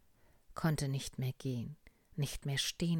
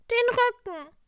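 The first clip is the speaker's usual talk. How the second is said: sick little tiger; 14 dB louder than usual. Acted. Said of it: "sagte der kleine Tiger".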